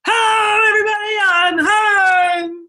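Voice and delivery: high, loud hearty hello as he walks in; dad greeting his family at the door, big and cheerful; cheery greeting. Retold or imitated. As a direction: boom it out like a happy dad coming home. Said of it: "he'd say".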